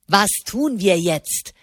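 slow, sneering parrot of his words; angry mocking imitation. A narrator's reading. Didini angrily mimics Di Silvestro's question, slow and mocking. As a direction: drag it out to mock him. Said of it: "äffte Didini ihn wütend nach".

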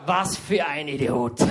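mock onlooker, slow sneering aside; a lightly voiced bystander scoffing; onlookers' scoff. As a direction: people in the queue judging him, mild mock scoff. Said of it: "habe ich gesehen, die anderen Leute".

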